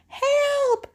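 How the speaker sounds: high, loud cry for help; grandmother's shout. Acted. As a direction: shriek it like a frightened storybook granny. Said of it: "shouts grandmother".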